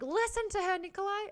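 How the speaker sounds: high-pitched, loud exasperated plea. Acted. Reader pleading with a character to listen, exasperated.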